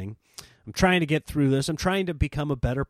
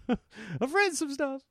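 laughing sheepishly; 10 semitones higher than usual. Real, not acted. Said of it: "repeat laughing, higher".

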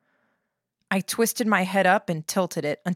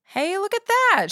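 mock amazement; 14 semitones higher, 4 dB louder than usual. A narrator's reading. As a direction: exclaim as if impressed by a feat. Narrator's mock-impressed inner remark.

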